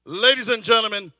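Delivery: ordinary talk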